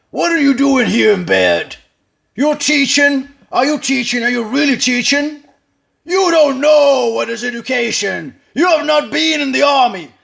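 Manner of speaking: loud, high barking colonel's tirade. Acted. Colonel berating the teacher, loud and high. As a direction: shout him down like an army colonel. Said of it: "this is the character of the colonel".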